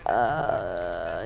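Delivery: a low, startled "uh"; stunned uh. Retold or imitated. Girl caught by teacher, stunned low "uh". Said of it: "And I'm like".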